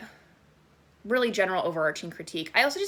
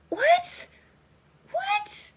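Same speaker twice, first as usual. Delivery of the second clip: disbelief. Retold or imitated. Incredulous at the plot twist.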